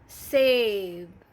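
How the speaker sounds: सेब drawn out with a long e; slow model reading. Shown as a demonstration. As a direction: model सेब slowly so the vowel sign is heard. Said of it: "उच्चारण change हो जाता है".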